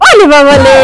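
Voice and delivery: put-on cooing baby-talk "ওলে বাবালে" with r turned to l; doting mother's baby talk. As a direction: coo "ওলে বাবালে" like to a toddler. Cooing at the child.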